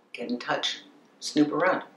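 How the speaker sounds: ordinary talk, nothing marked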